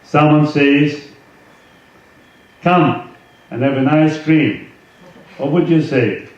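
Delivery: ordinary talk, nothing marked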